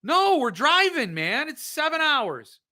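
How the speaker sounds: thrilled shout announcing a road trip; excited holler. Real, not acted. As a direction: yell it out to Jill like the trip is happening right now. Hollering about a New Orleans trip.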